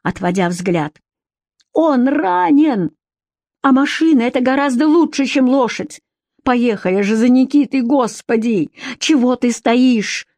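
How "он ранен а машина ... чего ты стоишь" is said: high, urgent, begging voice; a wife pleading with her husband to save Nikita; frantic pleading. A narrator's reading. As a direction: push him to act now, near panic.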